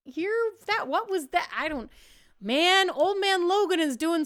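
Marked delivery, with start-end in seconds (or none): 0.0-1.5 puzzled villagers. Acted. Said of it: "looking around a little bit like".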